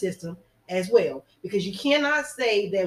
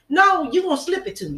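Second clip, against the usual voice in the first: louder, higher burst of protest; indignant retort; 6 semitones higher, 5 dB louder than usual. Acted. Woman calling out the little man's false promise, indignant. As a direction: cut the sweet-talker off, high and loud.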